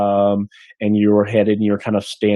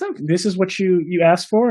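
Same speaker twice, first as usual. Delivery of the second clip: higher female voice, questioning; wife checking the order, high voice; innkeeper's wife; 8 semitones higher than usual. Acted. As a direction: hand over the order lightly. Innkeeper's wife brings steaks.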